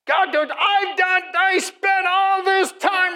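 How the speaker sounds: high, drawn-out whining yell; hammed-up tantrum at heaven; mock venting. Preacher imitating his own venting to God, whiny shouting. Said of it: "I just vented out to him".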